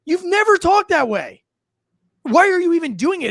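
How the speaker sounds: fed up, pitch jumping high; exasperated. Real, not acted. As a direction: scold a faker in incredulous exasperation. Exasperated rant at faked accent.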